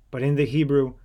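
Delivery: plain reading voice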